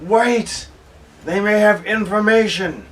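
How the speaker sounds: loud, high urgent call to halt; yelling out. Acted. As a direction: yell to stop your companions mid-fight. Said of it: "I yell out".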